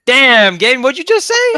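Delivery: high, loud hype-man yell; instigating shout. Retold or imitated. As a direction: stir the pot. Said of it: "Cameron would go".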